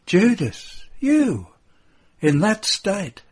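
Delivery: high, loud shocked exclamation; shocked cry. Acted. Mary of Alphaeus seeing drunk Judas, shocked cry. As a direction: cry out his name in disbelief.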